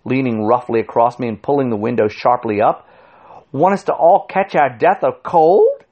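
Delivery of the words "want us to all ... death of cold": high-pitched scolding shout; outraged, raised-voice complaint. A narrator's reading. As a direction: demand it indignantly. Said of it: "shouted the intelligent man".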